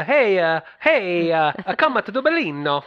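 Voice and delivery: loud, high, hammed-up Italian accent; badly faked, exuberant stage-Italian accent. Host imitates the Italian chef greeting diners, bad Italian accent. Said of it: "I don't do an Italian accent very well".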